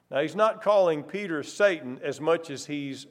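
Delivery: ordinary delivery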